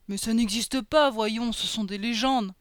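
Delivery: loud, dismissive ogre character voice; put-on ogre voice, dragging the last words; doubting ogre. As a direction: scoff as a big sceptical ogre. Ogre Boris doubts Lolisa.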